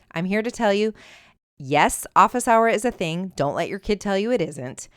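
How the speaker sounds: ordinary talk, nothing marked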